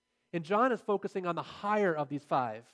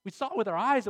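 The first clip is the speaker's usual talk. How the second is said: higher and louder, punching saw and eyes; preacher stressing eyes; emphatic eyes; 4 semitones higher, 4 dB louder than usual. Shown as a demonstration. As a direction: drive home eyes. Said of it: "There's an emphasis there on the use of eyes".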